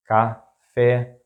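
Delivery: café split in two, ca - fé; syllable by syllable. Shown as a demonstration. Teacher breaking down an example word, café in separate syllables.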